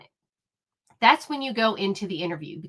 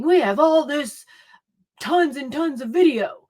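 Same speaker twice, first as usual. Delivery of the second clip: mock sergeant's raised voice, higher pitched; imitated yelling; 5 semitones higher than usual. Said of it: "yelling and raising his voice".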